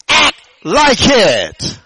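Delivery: high, slow, forceful exhortation; preacher's climactic command. Real, not acted. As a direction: punch and stretch each word for the congregation.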